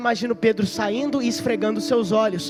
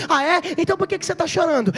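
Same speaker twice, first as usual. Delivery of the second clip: higher, fast scornful challenge; scoffing executioner; 8 semitones higher, 4 dB louder, faster than usual. Acted. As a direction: toss the question back, fast and high.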